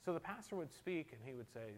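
normal talking voice

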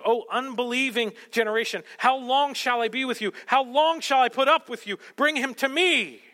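Jesus rebuking the faithless generation, exasperated; exasperated rebuke. Acted. Preacher voicing Jesus to the crowd, fed-up and loud. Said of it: "what affects him is their unbelief".

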